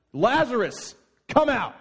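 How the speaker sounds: very loud, high shout. Acted. Jesus calling into the tomb, full shout. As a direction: call the dead man out of the tomb.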